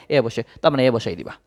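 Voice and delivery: normal talking voice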